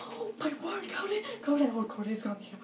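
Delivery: high, breathless fangirl squeal; high-pitched thrilled gasping; excited squeal. Retold or imitated. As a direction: squeal with excitement at seeing your hero. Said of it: "I got so, so excited".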